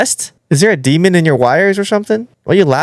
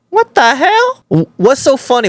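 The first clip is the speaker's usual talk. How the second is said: high-pitched, incredulous outburst; freaked-out, high exclamation; startled disbelief; 10 semitones higher than usual. Real, not acted. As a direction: blurt it out, pitch leaping up. Startled by the AI's laughing.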